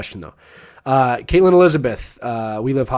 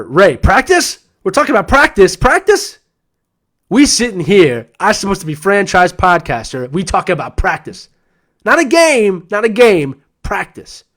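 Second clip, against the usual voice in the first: high-pitched, loud, hammed-up disbelief; mock incredulous rant; 6 semitones higher, 4 dB louder than usual. Podcaster answering Ray about practice, mock incredulous rant. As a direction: play up exaggerated outrage at the very question.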